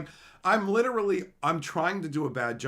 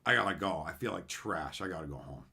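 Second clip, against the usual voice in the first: feeling unwell; 6 semitones lower, 4 dB quieter than usual. Retold or imitated. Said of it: "wasn't feeling good".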